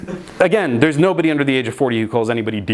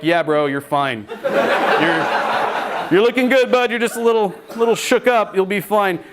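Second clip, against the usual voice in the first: laughing reassurance; 5 semitones higher than usual. Retold or imitated. Reassuring dazed friend, laughing.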